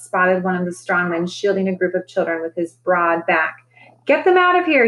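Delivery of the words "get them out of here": raised, high call over the crowd. A narrator's reading. Strongman calling to clear the tent. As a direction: shout to get the children out. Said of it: "he called".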